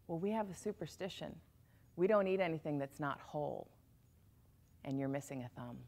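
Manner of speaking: soft cannibal voice. Acted. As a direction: explain the rule calmly and quietly. Cannibal explaining superstition.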